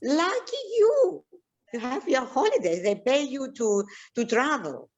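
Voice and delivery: high-pitched imitation of envious outsiders; hammed-up "lucky you" sing-song; mock envy. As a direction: gush in a mocking high voice. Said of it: "If you tell other people what you do".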